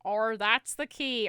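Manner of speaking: loud, stretched fake-Aussie R; mock Australian accent. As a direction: drawl it in a bad Australian accent. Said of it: "add an R to the end of your words".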